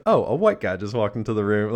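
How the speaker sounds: a hint of a breezy billionaire; Bruce Wayne voice. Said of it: "His Bruce Wayne voice cracks me up".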